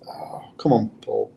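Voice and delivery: whispered under his breath, scolding himself; muttering to himself. Real, not acted. Mid-story, losing a word, he whispers at himself. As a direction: hiss it at yourself for blanking on a word.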